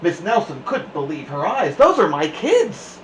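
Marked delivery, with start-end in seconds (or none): 1.8-3.0 scratchy voice. A narrator's reading. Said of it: "she said in a scratchy voice".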